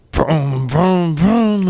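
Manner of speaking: revving engine, pitch rising; playful engine imitation; vroom noises. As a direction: make race-car vrooms. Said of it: "on the top it says".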